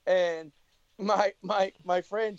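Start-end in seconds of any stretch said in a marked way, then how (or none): none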